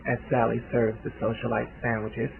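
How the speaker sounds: plain reading voice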